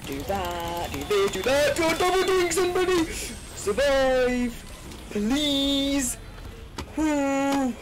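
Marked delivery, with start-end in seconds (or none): 0.9-3.0 high, loud excited urging; excited shouting. Real, not acted. Urging a teammate in game. 3.6-7.7 excited cheering. Real, not acted. Cheering in the game.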